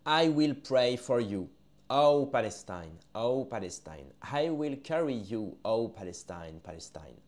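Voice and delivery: slow prayerful verse reading; solemn poem recital. Acted. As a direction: chant it like a prayer, then let it die away. Reads poem refrain, solemnly.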